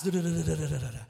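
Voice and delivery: quick pattering feet sound; running footsteps noise. Retold or imitated. Little feet running through house.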